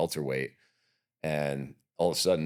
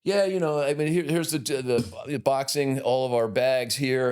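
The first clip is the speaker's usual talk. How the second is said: higher, louder casual gym-tour voice; buddy giving a tour; 8 semitones higher, 3 dB louder than usual. Retold or imitated. Friend showing the gym while kicking a kid, breezy and high. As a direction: show off the gym breezily, pitched up. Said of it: "And Dave's like".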